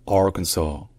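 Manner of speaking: "Arkansas" with a hard s, AR-kun-saw; "Arkansas" with no z sound; American Arkansas. Shown as a demonstration. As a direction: keep the s in "Arkansas" sharp, end on saw. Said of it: "prononcé comme un S dans Arkansas".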